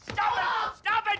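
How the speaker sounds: exasperated shout. Acted. Man orders the hysterical accountant to stop, shouting. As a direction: shout over someone's panic.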